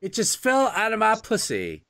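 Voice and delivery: high, breezy Mother Nature; mock nature voice. Nature voiced as careless giver.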